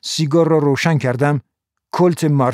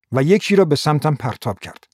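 usual speaking voice

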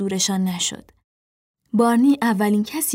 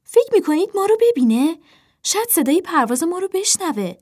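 high, raised voice, urgent question; high-pitched anxious cry; 8 semitones higher than usual. A narrator's reading. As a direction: call out high to the others. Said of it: "اپل فریاد زد".

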